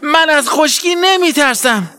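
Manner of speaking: high, brave shout; loud cry. A narrator's reading. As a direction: yell defiance at the dry land. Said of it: "بلند فریاد زد".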